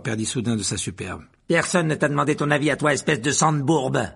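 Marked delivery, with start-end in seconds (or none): 1.5-4.1 raised, higher, venomous; angry, loud put-down; spat-out insult. Acted. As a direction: spit the insult out with contempt. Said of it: "éructa-t-il".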